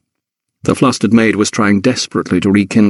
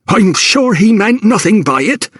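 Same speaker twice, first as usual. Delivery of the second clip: high, huffy cook's voice; 11 semitones higher than usual. A narrator's reading. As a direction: huff it out, pitched high and offended. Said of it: "she huffed".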